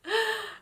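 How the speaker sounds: sudden high gasp; sharp gasp. Real, not acted. Tarot reader moved by the card she drew, gasp. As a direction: gasp as if overwhelmed.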